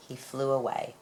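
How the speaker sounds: plain reading voice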